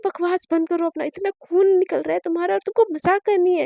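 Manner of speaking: angry scolding. Acted. Mother scolding joking daughter.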